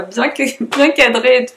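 laughing. Real, not acted. Laughs at own framing slip.

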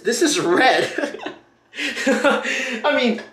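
slow, drawn-out words breaking into laughter; laughing in disbelief. Real, not acted. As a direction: let incredulous laughter burst out. Laughs in disbelief at red dye.

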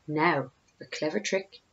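hushed whisper to himself; sly scheming whisper. A narrator's reading. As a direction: breathe it secretly, scheming. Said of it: "he whispered to himself".

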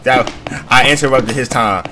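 loud, rushing apology and exit; flustered loud retreat. Real, not acted. Gamer fleeing the ghost he interrupted, loud and rushed.

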